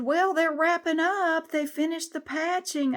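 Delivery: high, slow mock office lady voice; office manager lady. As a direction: lilt it up high like a breezy receptionist. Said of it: "She said".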